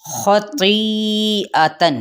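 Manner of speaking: خطیئتن very slowly, each sound drawn out and the hamza clear; careful pronunciation. Shown as a demonstration. Teacher models the word for students, slow and exact. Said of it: "حمزہ کو واضح طور پہ ادا کرنا ہے".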